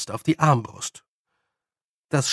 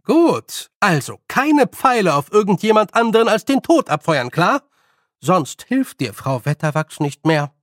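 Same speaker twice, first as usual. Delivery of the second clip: higher, loud firm instructions; firm old witch, warning; 5 semitones higher, 5 dB louder than usual. Acted. Nanny warns old man about firing arrows, firm. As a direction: lay down the rule firmly.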